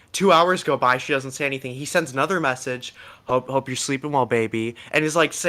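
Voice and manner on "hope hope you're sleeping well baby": ordinary talk, nothing marked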